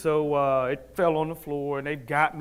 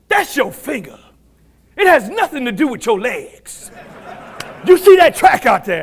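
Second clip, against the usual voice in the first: loud, high, emphatic coach bellow; stern coach shouting; 8 semitones higher, 11 dB louder than usual. Retold or imitated. Coach to athlete with injured finger, stern shouting. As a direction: build to a forceful holler.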